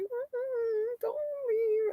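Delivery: high, soft whimpering dog voice; imitating crying dog. Voicing her crying dog, a pleading whimper. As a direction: whine it like a sad puppy. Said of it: "he started crying".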